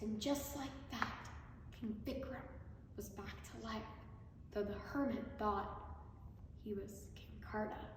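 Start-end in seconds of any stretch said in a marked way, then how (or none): none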